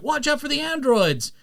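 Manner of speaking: high, mock-urgent warning; hammed-up cartoon alarm; Trunks. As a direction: cry out a warning like an anime hero. Voicing Trunks's warning from the show.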